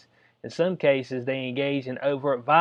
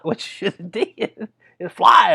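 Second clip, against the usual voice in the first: laughing through the words, louder; 4 semitones higher, 8 dB louder than usual. Real, not acted. Vlogger cracking himself up over the diner fight, laughing. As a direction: crack up while giving the advice. Laughing at his own joke.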